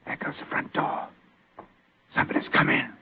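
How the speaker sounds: tense whisper in the dark; hushed whisper. Acted. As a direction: whisper so the intruder can't hear. Hiding in the dark, hearing intruder.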